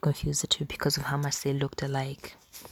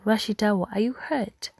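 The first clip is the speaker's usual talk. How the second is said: high, anxious rising concern; fretting over her hurt husband; worried; 7 semitones higher, 3 dB louder than usual. A narrator's reading. Mother asks her husband if he is hurt, high worried voice. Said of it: "asked with worry in her voice".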